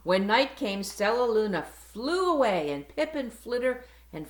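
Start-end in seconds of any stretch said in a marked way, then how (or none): none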